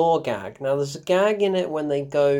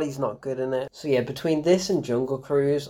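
ordinary talk

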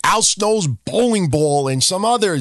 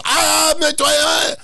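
mock yell; 9 semitones higher, slower than usual. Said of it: "then he fucking yells".

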